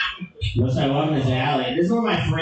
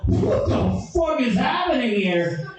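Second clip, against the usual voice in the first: high, strained disbelief; mock panicked outburst; freaked out; 11 semitones higher than usual. Freaking out in alley.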